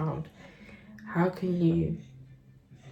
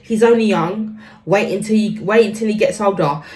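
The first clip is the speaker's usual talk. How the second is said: imitating mother; 3 semitones higher, 7 dB louder than usual. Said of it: "I'm literally replying".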